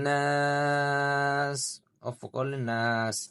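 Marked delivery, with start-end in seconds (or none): 0.1-1.6 slow, melodic tajweed chant, pitched up; measured Quranic recitation. Acted. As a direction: recite slowly with long drawn vowels. Recites the ayah's ending word.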